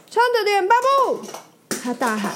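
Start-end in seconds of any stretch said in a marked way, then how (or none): 0.1-1.2 much higher, louder call; urgent high shout. Acted. Mater calling out to flipped Bob, urgent. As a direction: shout encouragement across the track. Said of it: "发出尖锐的声音".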